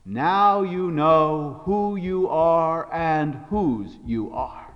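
very slow, weighty delivery, falling away at the end; solemn pronouncement. Acted. Big lion to little lion after the roar, solemn pronouncement. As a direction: intone it slowly, each word given room.

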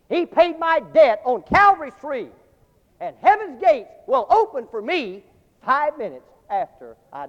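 loud, slow, declaimed verse; poem recitation. Acted. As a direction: proclaim the poem slowly with fervor.

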